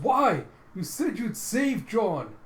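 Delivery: high, loud accusing cry; anguished yell. Acted. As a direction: wail it out, drawing out the plea. Vilma hammers on his chest, protesting.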